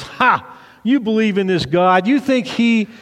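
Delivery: mocking Goliath. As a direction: jeer down at the little army. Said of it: "and he goes".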